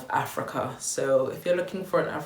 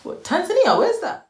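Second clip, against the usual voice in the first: much higher, louder, astonished yelp; baffled shout; 7 dB louder than usual. Acted. Guy hears she's from Tanzania, loud baffled shout. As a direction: blurt it out in loud disbelief.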